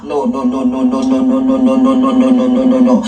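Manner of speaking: low, steady string of no's; emphatic refusal. Real, not acted. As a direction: shut it down with a chant of no.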